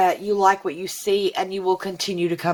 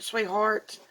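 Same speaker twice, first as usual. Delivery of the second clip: ordinary delivery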